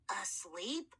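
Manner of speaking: low, soft raspy mummy; hoarse mummy voice. Acted. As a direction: croak the word puzzled. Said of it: "a very hoarse voice".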